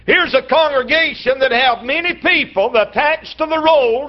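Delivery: ordinary talk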